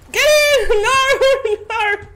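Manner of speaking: loud, high panicked shout; panicked yell. Real, not acted. Gamer yelling at the screen in panic, loud.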